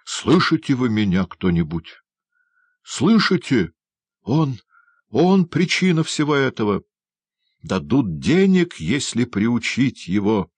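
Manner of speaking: raving, voice jumping up on each call; agitated calling out. A narrator's reading. Condemned prisoner raving at anyone who hears, agitated calling out.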